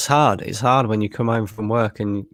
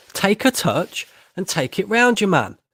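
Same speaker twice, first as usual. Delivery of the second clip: exasperated yell; 9 semitones higher than usual. Real, not acted. Frustrated fan pleading with player.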